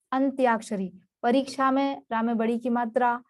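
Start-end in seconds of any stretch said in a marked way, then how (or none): none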